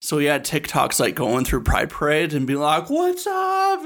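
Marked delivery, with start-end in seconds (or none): 2.9-3.8 very high, drawn-out hollered hello; mock excited greeting. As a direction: imitate a thrilled newcomer calling out high. Mimics excited man filming at parade.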